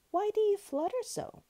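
very high, loud lamb voice; high storybook lamb question; puzzled lamb. A narrator's reading.

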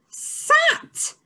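thrilled high shout; excited shout. Shown as a demonstration. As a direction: shout the word in triumph. Teacher shouts blended word.

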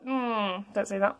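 usual speaking voice

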